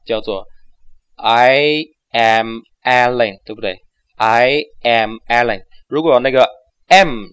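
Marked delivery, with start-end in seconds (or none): 1.2-5.6 slow, separate words. Shown as a demonstration. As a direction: split each word apart. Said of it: "我们一个字一个字地讲". (一个字一个字地讲)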